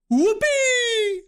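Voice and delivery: a gleeful whoop; cheering. A narrator's reading. Uncle cheering as he throws diamonds, high whoop. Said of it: "Uncle Plart cheered".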